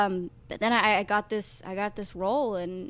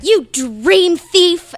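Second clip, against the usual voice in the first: loud, slow, drawn-out accusing cry; hammed-up, higher-pitched accusation; mock accusation; 9 semitones higher, 15 dB louder, much slower than usual. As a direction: imitate a wronged kid, hammering each word slowly. Retelling a classmate's accusation.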